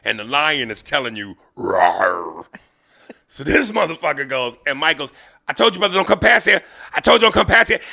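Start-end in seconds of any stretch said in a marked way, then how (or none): 1.6-2.4 lion roar. Retold or imitated. Lion imitated at the zoo. 5.5-7.8 higher, louder, fast warning shout; Mike yelling. Retold or imitated. Mike yells at the photographer before charging, loud and fast.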